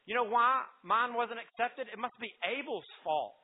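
angry Cain. Acted. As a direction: act out blaming someone, a touch heated. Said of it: "He's angry at Abel".

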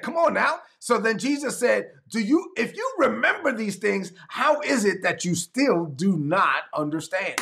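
high, pleading disbelief; exasperated. Retold or imitated. Jesus pleading with the disciples to understand, exasperated. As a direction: come on, how can you not see. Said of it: "He said".

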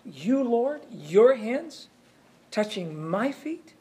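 preacher voicing Peter's shock at Jesus washing his feet; shocked protest. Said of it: "the shock of this thing".

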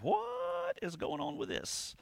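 long, high-pitched baffled "what"; wondering aloud. Acted. Preacher voices readers puzzled by a hard verse, baffled and drawn out. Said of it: "and kinda wondered".

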